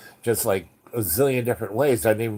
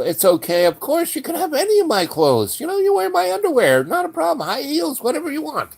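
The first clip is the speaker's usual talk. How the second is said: much higher, sweet motherly woman's voice; wife comforting. Retold or imitated. John's wife reassuring Yos, high and soothing. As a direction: coo reassurance like a kind wife.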